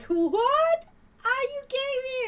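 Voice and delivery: imitating gleeful disbelief, very high and loud; hammed-up high squeal of incredulous amusement; Peter. Retelling a cartoon to viewers, imitated laughing disbelief. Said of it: "it's funny how Peter laughs about it".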